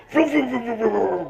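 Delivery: put-on boy races the turtle along the sidewalk like a car; car vroom noise. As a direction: make the engine noise like a toy car. Imitates turtle racing along.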